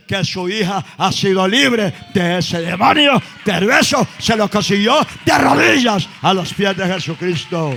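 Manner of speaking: loud, high shouted climax; preaching at full force. Acted. Preacher shouting the miracle to the congregation, full force. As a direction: build it to a roar of praise.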